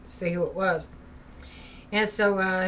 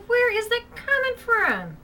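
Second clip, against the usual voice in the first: higher, slow bewildered call; puzzled call; 13 semitones higher, slower than usual. Retold or imitated. Mother hears the ghost again, higher and slow with bewilderment. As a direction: wonder aloud where the voice comes from.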